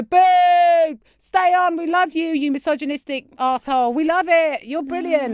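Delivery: high, loud mock jeering crowd; mock crowd shouting. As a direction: shout like a rowdy pub audience. Retells the audience begging him to stay.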